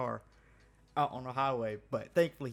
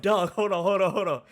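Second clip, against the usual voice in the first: loud, higher-pitched cut-in; urgent retold interruption; 6 semitones higher, 11 dB louder than usual. Worker halting his panicked friend on the phone, loud and urgent. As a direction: burst in to stop a panicking friend, half laughing.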